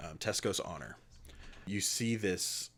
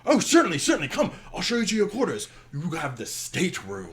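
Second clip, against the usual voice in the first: much higher and louder, eager to please; fawning host showing the way; eager minotaur captain; 10 semitones higher, 9 dB louder than usual. Acted. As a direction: minotaur reassuring the passenger about the bags, high and eager. Minotaur answers the player.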